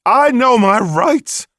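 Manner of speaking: high, loud, slow defiant yell. Acted. Hunter yells at the sheriff before storming away, defiant. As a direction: shout it as you storm off. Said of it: "he yelled".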